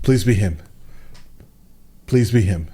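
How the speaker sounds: low-pitched retold pleading; mock muttered plea. Audience pleading under their breath.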